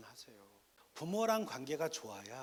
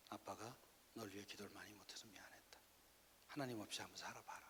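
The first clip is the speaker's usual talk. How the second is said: hushed, low fatherly voice; subdued retold father's confession; acted quiet apology; 4 semitones lower, 12 dB quieter than usual. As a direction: apologise softly to your son, then let him go.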